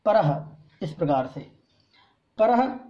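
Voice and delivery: परह with a faint h release; light visarga h. Shown as a demonstration. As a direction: breathe a light h after परह. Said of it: "तो हल्का सा हा बोलना पड़ता है।".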